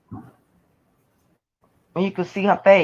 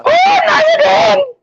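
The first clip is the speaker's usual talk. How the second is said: shouting; 5 dB louder than usual. Real, not acted. Friend calls in, mock outrage.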